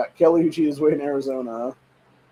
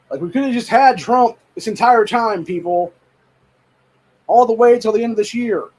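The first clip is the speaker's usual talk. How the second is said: higher and louder, furious scolding; angry rant; 6 semitones higher, 4 dB louder than usual. Real, not acted. Scolding the people who voted for him, heated and raised. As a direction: chew out the audience, voice raised.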